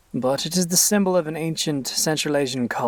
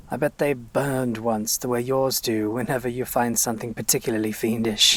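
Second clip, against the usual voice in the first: usual speaking voice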